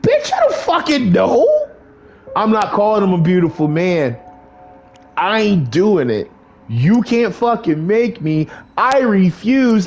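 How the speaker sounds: flatly refuse, pushing back hard; defiant outburst. Real, not acted. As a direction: player refusing the dialogue option. Player refuses the dialogue choice.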